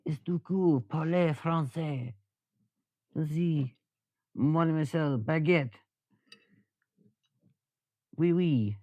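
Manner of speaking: high, singsong mock French; badly faked, drawn-out French accent. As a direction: ham up a cartoon French accent, slow and high. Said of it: "do a bad accent of them".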